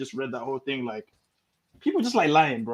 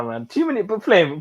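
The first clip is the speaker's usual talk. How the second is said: usual speaking voice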